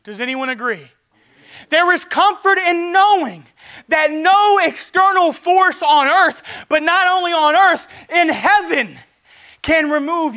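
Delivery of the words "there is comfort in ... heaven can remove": loud, high, slow proclamation; preaching at full force. Acted. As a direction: lift the church with it. Preaching climax on security.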